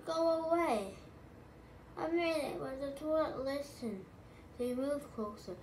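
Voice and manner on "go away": cried out. A narrator's reading. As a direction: read it as a high-pitched cry. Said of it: "she cried".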